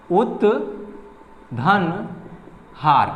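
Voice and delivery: उत्धन stretched out very slowly, sound by sound; slow syllable by syllable. Shown as a demonstration. Teacher dictating उत्धन to students, drawn out very slowly. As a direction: spell out उत्धन हार slowly for the class. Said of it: "इसका example है".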